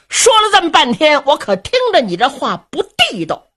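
put-on angry older brother calling out a friend; angry reproach. A narrator's reading. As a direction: jab at him with indignation, pitch up. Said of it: "双眉倒竖， 二目圆睁".

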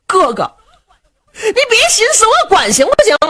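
high, loud panicked plea; alarmed giving in. Acted. Luo Cheng begs Du Cha not to kill himself, panicked. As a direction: rush to stop him, panicked.

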